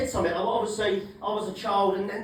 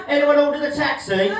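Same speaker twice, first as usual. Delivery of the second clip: snooty waitress; 6 semitones higher, 6 dB louder than usual. Retold or imitated. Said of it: "she went".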